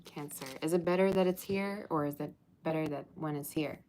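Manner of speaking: usual speaking voice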